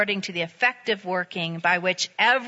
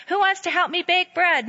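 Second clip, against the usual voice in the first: little red hen; 8 semitones higher than usual. A narrator's reading. Hen asks the animals.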